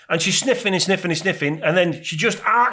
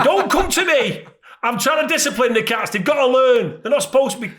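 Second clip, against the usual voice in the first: higher-pitched exasperated protest; fuming; 6 semitones higher than usual. Retold or imitated. Husband defending his cat discipline to wife, indignant retold protest. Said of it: "so I was fuming".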